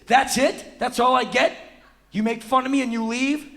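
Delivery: high, loud, disbelieving questions; indignant protest. Retold or imitated. Man dissed by six-year-old, indignant protest.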